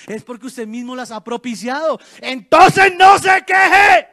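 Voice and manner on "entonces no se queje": "no" drawn out, loud and high; slow shouted rebuke hammering "no"; stress on no. Real, not acted. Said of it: "preacher stresses the word no". ("no" enfatizado, lento y gritado)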